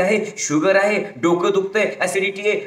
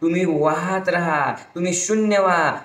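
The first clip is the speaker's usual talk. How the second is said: ordinary delivery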